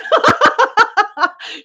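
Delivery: high staccato cackling laugh; real laughter. Real, not acted. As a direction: crack up in quick high bursts. Cracking up at chat.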